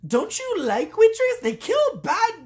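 high-pitched mock indignation; incredulous protest. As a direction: protest it as if baffled by their ingratitude. Acting out own protest at villagers.

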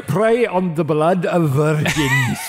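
put-on slow, drawn-out Transylvanian vampire accent; hammy Count with a Transylvanian accent. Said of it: "put on your Transylvanian accent".

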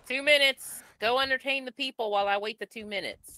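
loud, high-pitched holler; calling back. Pretend. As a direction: holler an order to someone outside. Shouts back to the caller in play.

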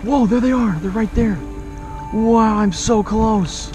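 ordinary talk, nothing marked

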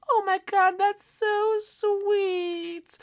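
very high, drawn-out falsetto gush; gushing store lady. Acted. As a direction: melt over a sweet compliment. Lady touched by apparent compliment.

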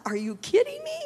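sharp high disbelief, are you kidding; incredulous. Retold or imitated. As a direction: leap up in pitch, half laughing at the idea. Retold plea to pastor, incredulous.

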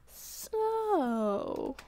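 put-on high, eager teenage girl; teen daughter voice. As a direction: pester Dad like an excited teen.

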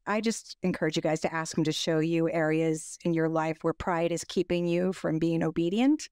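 ordinary talk

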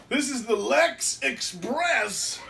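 slow, high announcer call. Pretend. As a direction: boom it out like a ring announcer. Announcing wrestler's catchphrase.

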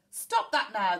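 loud captain's order; bellow. A narrator's reading. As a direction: bellow it firmly at the hippo. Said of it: "the captain bellowed".

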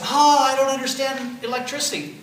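teacher imitating students who find electricity hard; complaining student. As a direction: mimic a confused student. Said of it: "I have students say".

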